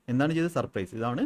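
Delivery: normal talking voice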